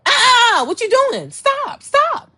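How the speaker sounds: high, alarmed cry of stop; startled stop. Retold or imitated. Telling him to stop mid-act, high and alarmed. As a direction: yelp it out in alarm. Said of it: "I just had to let his ass know".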